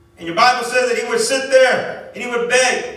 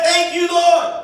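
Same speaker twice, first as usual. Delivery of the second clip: praising the Lord; 4 semitones higher, slower than usual. Retold or imitated. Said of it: "won't allow you to say".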